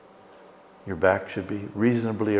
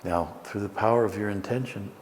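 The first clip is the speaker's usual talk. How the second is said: guided meditation; 4 dB quieter than usual. Acted. Leads a guided breathing meditation.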